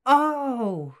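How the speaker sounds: loud high realisation; sudden loud surprise; surprised. Real, not acted.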